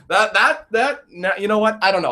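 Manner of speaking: ordinary delivery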